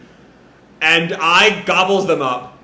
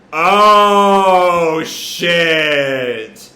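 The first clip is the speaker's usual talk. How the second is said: loud, stretched-out exclamation; drawn-out shock; 6 dB louder than usual. Acted. Recapper reacting to a sudden attack, drawn-out shock. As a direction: hold each word long, starting loud and falling away.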